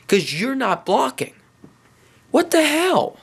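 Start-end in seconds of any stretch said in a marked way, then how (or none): 2.3-3.2 fed-up Jets fan yelling at his own team; exasperated outburst. Real, not acted. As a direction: throw your hands up in exasperation.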